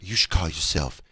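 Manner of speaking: low, slow mogul impression. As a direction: advise it slowly, deep down low. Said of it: "'Cause [name]'s like".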